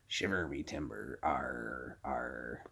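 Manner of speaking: slow, growly pirate talk. Acted. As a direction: ham it up like an old sea dog. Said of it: "funny pirate joke".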